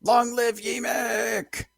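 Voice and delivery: high, slow, shouted chant; shouted cheer. Acted. Player's goblin hailing the new king, high drawn-out shout. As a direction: roar it like a crowd hailing a king.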